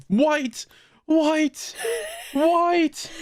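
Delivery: retold scream. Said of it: "pointing at the screen and screaming".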